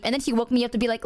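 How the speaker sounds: normal talking voice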